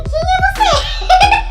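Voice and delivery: put-on very high, very slow, laughing drunk question; loud, stretched, giggling drunk "Quem é você?"; drunk woman. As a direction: ask it like you're giggly drunk and can't recognise anyone. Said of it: "Porque eu fico muito doida".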